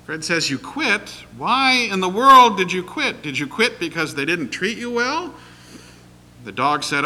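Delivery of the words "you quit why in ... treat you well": high, loud incredulous questions; astonished questions. Retold or imitated. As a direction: ask in disbelief, rising high. Preacher astonished by talking dog.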